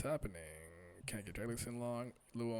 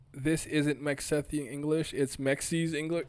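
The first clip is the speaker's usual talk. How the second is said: loud, slow, higher delivery; mocking announcement; 5 semitones higher, 12 dB louder, slower than usual. Real, not acted. Reader mocks the bad translation, loud slow announcement. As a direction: declare it loudly, word by word.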